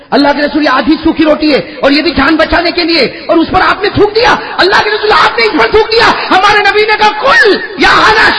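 high loud sermon shouting; fervent sermon shout; preaching at full force. Acted. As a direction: rouse the crowd. Preacher voices dialogue at climax.

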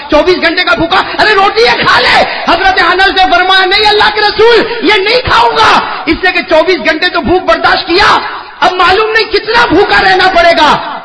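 high, loud preacher's cry; fervent sermon shout; preaching at full force. Acted. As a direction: build the crowd up. Preacher voices dialogue, rising.

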